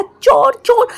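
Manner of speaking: high loud alarmed yell; startled shout of thief. Acted. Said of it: "वो बहुत ज़ोर से चिल्लाया".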